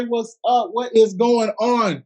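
hype greeting. Shown as a demonstration. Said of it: "Unmute yourselves and say".

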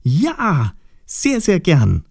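high, elated exclamation; joyful cry. Acted. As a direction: call it out with delight, pitched well up. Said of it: "riefen beide gleichzeitig".

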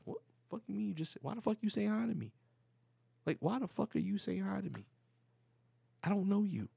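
high-pitched, incredulous demand, not shouted; retold indignant challenge. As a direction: re-enact confronting a stranger. Acts out his suspicious challenge.

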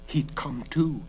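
ordinary delivery, nothing marked

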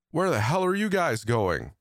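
shouting from behind; calling out. A narrator's reading. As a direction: call out across the hall. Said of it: "Adrian calling out from behind me".

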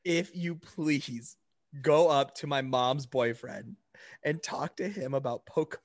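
ordinary talk

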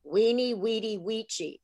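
"Weenie, Weedy, Weechi" said with the w sound and a ch sound; "Weenie, Weedy, Weechi" pronounced as in classical Latin. Shown as a demonstration. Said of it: "is actually Weenie".

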